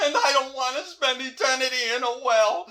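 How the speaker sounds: high-pitched theatrical wailing; plaintive wail. Acted. Ghost dreading eternity in a well, wailing.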